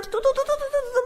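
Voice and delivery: high quick rattled tu-du-du for falling teardrops; imitated dripping tears. Telling how Jesus wept in the dream, high pattering tear sounds. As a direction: mimic fat tears pattering down.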